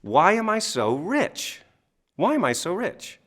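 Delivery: a slow, hopeful self-question; slow wondering question. Shown as a demonstration. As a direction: ask it as if truly curious. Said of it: "then I said".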